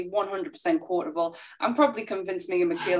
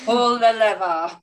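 villain quote; 3 semitones higher, 5 dB louder, slower than usual. Retold or imitated. Quoting the film's villain line.

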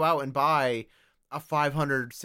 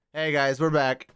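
normal talking voice

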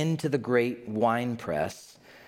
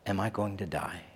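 low, soft frightened question; fearful hush; 4 semitones lower, 6 dB quieter than usual. Retold or imitated. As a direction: ask it quietly, dreading. Hopkins finally asks.

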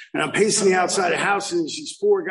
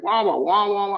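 mocking droning therapy talk; 5 semitones higher than usual. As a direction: mimic tuned-out instructor chatter. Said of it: "and it was like".

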